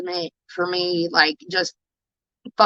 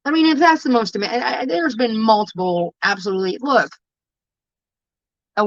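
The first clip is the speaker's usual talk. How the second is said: upset; 5 semitones higher than usual. Real, not acted. Self-disgust rant, pitch shoots up.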